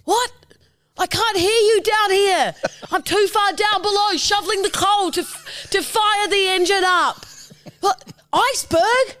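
high yelling coal shoveler below deck; shouting over machinery. Acted. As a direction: yell up from the engine room. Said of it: "You're a coal shoveler in the Titanic".

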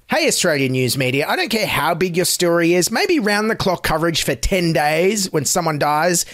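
ordinary talk

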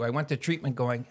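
ordinary talk

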